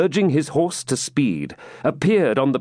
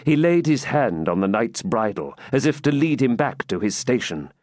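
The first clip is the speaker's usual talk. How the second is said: ordinary delivery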